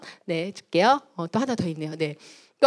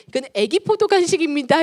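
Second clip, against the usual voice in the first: higher, louder toy-shop announcement; playful presentation; 6 semitones higher, 6 dB louder than usual. Acted. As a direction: present it like a pretend-play treat. Presenting tiny grape snack playfully.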